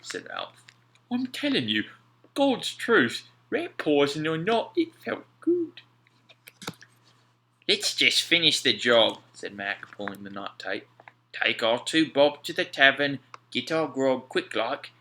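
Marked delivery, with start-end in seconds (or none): 1.1-5.8 much higher, louder, slowing at the end; swearing it felt good, high and wondering; Alf, awed sailor. A narrator's reading. Alf telling Mac about the trunk, high awed voice. 7.7-9.2 louder, brisk sailor voice; Mac, gruff sailor. A narrator's reading. Mac to Alf, wanting the job done, brisk voice. 11.3-14.9 a sailor eager for the tavern; Mac, thirsty sailor. A narrator's reading. Mac voiced in story.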